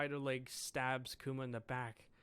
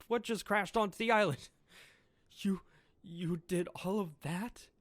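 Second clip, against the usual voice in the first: startled outburst; 5 semitones higher, 4 dB louder than usual. Acted. Reaction to the crash.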